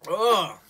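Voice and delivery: loud groan into a high laugh; disgusted groan then laugh. Real, not acted. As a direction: groan at the sour taste, then squeal. Sourness reaction.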